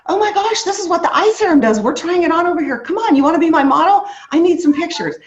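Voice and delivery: high, loud and fast, calling to onlookers; excited showy pitch. Retold or imitated. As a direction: draw the whole queue in with your buzz. Hyping strangers in Starbucks.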